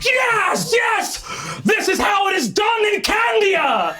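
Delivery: high excited shout from the stands; cheering shout. Acted. A fan in the stands cheers the joust, shouting high. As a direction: yell it like a wild fan in the crowd. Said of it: "a crawler over in the stand is like".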